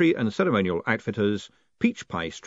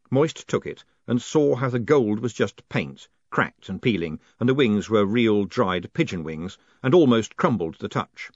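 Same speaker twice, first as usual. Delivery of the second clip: usual speaking voice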